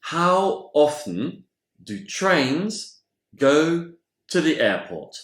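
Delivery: très lent, chaque mot bien détaché; lent et articulé. Shown as a demonstration. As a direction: dictez la phrase modèle pour les élèves. Phrase modèle dictée lentement. (phrase d'exemple prononcée très lentement)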